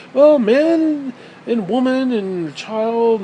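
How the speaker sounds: high, loud, slow mockery; mocking imitation. Mimicking people who would redefine words, drawn-out mockery. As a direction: drag out each word in a mocking high voice. Mocks those changing dictionary definitions.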